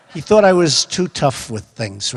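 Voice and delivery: ordinary delivery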